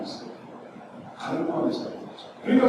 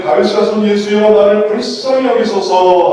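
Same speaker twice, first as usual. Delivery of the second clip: loud, raised plea for mercy; crying out; 3 semitones higher, 7 dB louder than usual. A narrator's reading. Blind beggar calls to Jesus, crying out. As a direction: cry out loudly over a crowd. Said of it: "소리질러 이르되".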